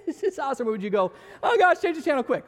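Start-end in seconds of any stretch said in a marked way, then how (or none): 0.1-0.7 high, laughing mock enjoyment; mock delight. Viewer enjoying the show with Jesus beside them, mock delight. 1.4-2.4 high, hammed-up alarm; mock panic. Viewer realizing Jesus is sitting next to them, mock panic.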